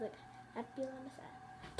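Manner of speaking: ordinary delivery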